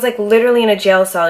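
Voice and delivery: normal talking voice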